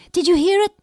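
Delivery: put-on thrilled young girl asking; excited question. A narrator's reading. Girl eagerly asks Dippy.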